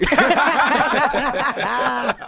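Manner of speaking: big high burst of laughter; loud laugh. Real, not acted. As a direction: let out a loud hearty laugh. Laughs at Fifty story.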